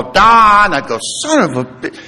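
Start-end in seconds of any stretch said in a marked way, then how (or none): 0.0-0.7 mock yell of a name, high and loud; retold call. Dying father-in-law summons his son-in-law at night, loud high call. Dying man yells for speaker. 1.0-2.0 higher-pitched cut-off curse, played for laughs; mock exasperation. Son-in-law hears himself called once more, comic exasperated curse.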